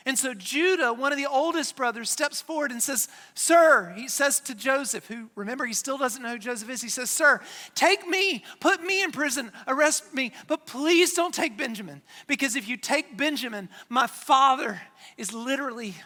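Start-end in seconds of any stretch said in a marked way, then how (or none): 3.3-3.9 loud, raised appeal; earnest plea. Acted. Judah steps forward to address the ruler, pleading. 7.1-15.9 raised, forceful appeal; urgent pleading. Acted. Judah begs Joseph to take him instead, pleading.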